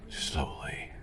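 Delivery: very soft, drawn-out whisper; whispered instruction. Acted. Doctor guiding a patient's breathing, whispered. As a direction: breathe the word out almost silently.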